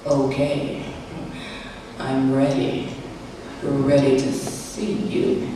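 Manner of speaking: low, soft man's voice, slowing at the end; intent lover. Acted. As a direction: play the solemn, unhurried lover. Voicing Bob's earnest, hushed request.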